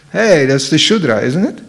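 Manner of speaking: normal talking voice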